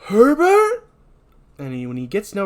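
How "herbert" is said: high, loud call across a house. Acted. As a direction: call out for someone. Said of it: "Dan calls out".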